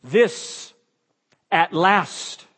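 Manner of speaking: loud, high joyful cry; Adam shouting for joy over the woman; retold joyful shout. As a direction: cry it out in delight at last. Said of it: "he shouts out".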